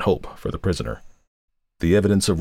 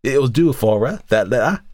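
spiteful crone; 7 semitones higher, 4 dB louder than usual. A narrator's reading.

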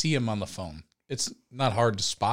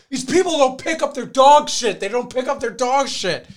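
high, loud outraged yelling; screaming rant; 14 semitones higher, 10 dB louder than usual. Retold or imitated. Fallen friend starts a neighborhood campaign, screaming. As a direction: rant at full volume like an imitated angry friend. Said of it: "And he starts screaming".

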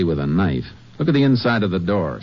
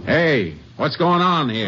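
calling out; 10 semitones higher, slower than usual. Acted. Ranger hails men round the truck, calling out. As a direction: call out, higher and sharp with alarm.

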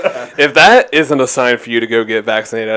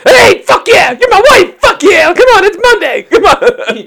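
manic high-pitched mock yelling; hammed-up hype, loud and high; Gabe; 10 dB louder than usual. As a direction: greet your wife at a party-crazed scream. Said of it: "she walks in, like".

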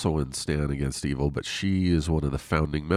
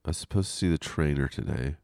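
ordinary delivery

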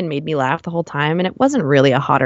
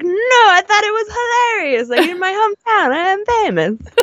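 put-on Italian accent. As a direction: ham up an Italian guy's accent, pitched high. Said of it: "like, Italian accent or something".

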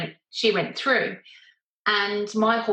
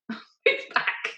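laughing through it. Retold or imitated. Rider repeats her order to the paramedic, laughing. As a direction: Move back squeaked out high through giggles. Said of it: "And I'm saying to him".

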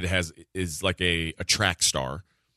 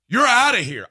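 loud, high ejection call; an umpire throwing the coach out, loud and high; umpire ejecting; 11 semitones higher, 7 dB louder than usual. Retold or imitated. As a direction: bark the ejection like an ump. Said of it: "the ump behind me is like".

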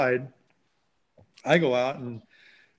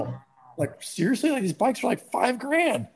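disbelief; 9 semitones higher than usual. Retold or imitated. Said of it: "John and I are like".

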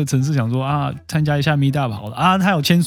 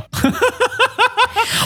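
long high laugh. Real, not acted. Host laughing at her plan to shut the group down. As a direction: laugh freely for a moment.

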